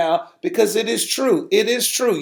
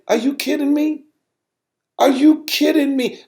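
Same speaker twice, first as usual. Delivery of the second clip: angry disbelief; 9 semitones higher than usual. Retold or imitated. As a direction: replay his silent outrage at the giving. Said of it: "I was angry".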